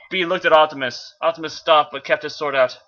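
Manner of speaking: plain reading voice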